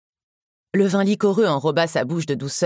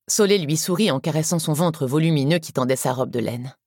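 plain reading voice

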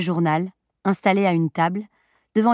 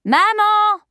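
very high, loud call to mother; insistent call; 14 semitones higher, 5 dB louder than usual. A narrator's reading. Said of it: "Appela-t-elle de nouveau". (appel insistant, fort et très aigu)

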